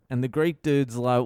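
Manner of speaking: usual speaking voice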